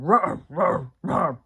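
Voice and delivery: loud, gruff dog barking; forceful throaty dog bark impression; deep, gruff bark. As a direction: imitate a serious dog's gruff woofs. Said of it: "goes in this deep, gruff kind of".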